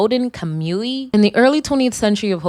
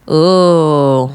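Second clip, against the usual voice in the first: 1 reacting ooh; 4 semitones lower, 7 dB louder than usual. Real, not acted. Reaction to letter.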